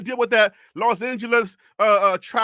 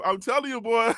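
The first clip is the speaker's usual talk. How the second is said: ordinary talk, nothing marked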